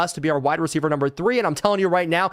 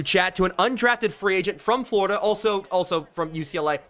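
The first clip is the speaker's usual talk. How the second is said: usual speaking voice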